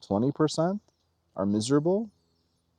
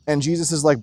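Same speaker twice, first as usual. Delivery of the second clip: ordinary delivery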